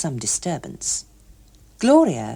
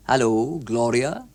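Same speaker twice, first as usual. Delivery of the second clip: lightly voiced stiff older man; a hint of a stuffy tax inspector; lower man's voice; 6 semitones lower, slower than usual. A narrator's reading. Older man greeting Gloria outside the cinema, lower voice. Hughes Evans voiced low.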